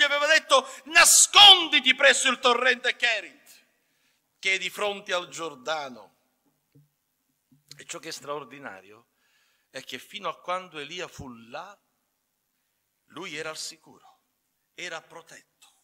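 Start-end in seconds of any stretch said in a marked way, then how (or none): none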